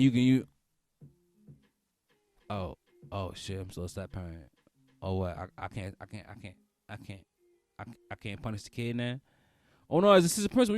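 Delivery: normal talking voice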